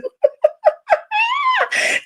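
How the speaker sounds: high, screaming laughter, real; shrieking laugh. Real, not acted. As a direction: crack up into a shriek. Cracking up at the fight.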